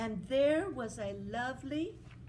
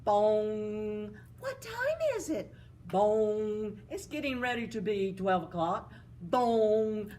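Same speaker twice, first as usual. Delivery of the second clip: loud mock clock chime; clock bong; 6 dB louder, slower than usual. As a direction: ring out each bong. Clock striking twelve.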